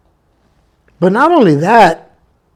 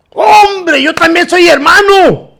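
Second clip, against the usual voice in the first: excited; 9 semitones higher, slower than usual. Retold or imitated. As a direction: burst out happily to a fellow believer. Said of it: "He was excited". (emocionado y alegre)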